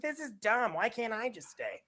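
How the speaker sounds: retold protest.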